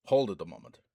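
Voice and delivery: loud, curt order to halt; a dwarf snapping at Carl to wait; sharp snap. A narrator's reading. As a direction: snap it out to stop him. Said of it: "the dwarf snapped".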